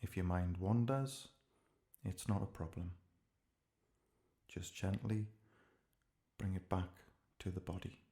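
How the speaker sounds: ordinary talk, nothing marked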